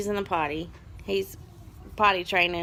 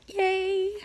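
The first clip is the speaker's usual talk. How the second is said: high, fake-excited yay; mock enthusiasm; sarcastic cheer; 11 semitones higher than usual. Real, not acted. Sarcastic cheer for Seuss week.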